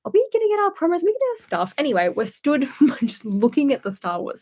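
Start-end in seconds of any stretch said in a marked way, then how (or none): 0.1-1.7 much higher, mock excited asking; high eager fan voice; eager whisper-high question. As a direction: squeak it out like a giddy fan. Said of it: "Blaine and I are just like".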